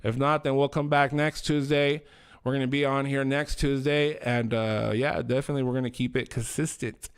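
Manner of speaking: ordinary delivery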